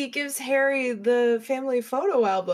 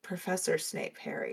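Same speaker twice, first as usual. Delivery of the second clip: stern Dumbledore correction; 8 dB quieter than usual. Retold or imitated. Dumbledore quoted correcting Harry.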